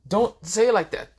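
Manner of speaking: high, loud embarrassed yelp; flustered protest. Acted. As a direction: squeak it out, mortified. Issei embarrassed, mad red.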